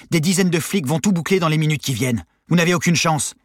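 high, loud, urgent argument; shouted warning. A narrator's reading. Guard warns escapees under countdown, urgent shout. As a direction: shout to convince them they'll fail. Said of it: "cria Jérémy".

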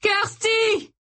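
very high, desperate call; high-pitched horrified yell; cry. A narrator's reading. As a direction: scream after your child. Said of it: "cria Tony".

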